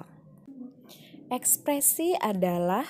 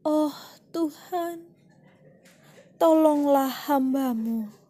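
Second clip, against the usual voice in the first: higher-pitched, sorrowful plea to God; sedih; 6 semitones higher, 5 dB louder than usual. Shown as a demonstration. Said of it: "yang diekspresi sedih". (ekspresi sedih, memohon dengan nada tinggi)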